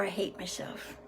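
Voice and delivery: hushed, low near-whisper; low, soft self-reproach; self-hating human. Acted. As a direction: drop right down as if ashamed. Human's two opposite self-judgments read out.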